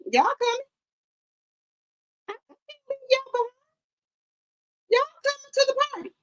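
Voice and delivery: high, sing-song tease, quick at the end; playful falsetto. Pretend. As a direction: coax them up in a squeaky high voice. Teasing viewers about the party.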